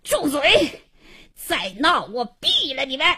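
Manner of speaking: strained, high-pitched threatening shout; rasping, enraged command; hoarse angry bellow. A narrator's reading. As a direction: roar them into silence at gunpoint. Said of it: "嘶哑着嗓子喝道".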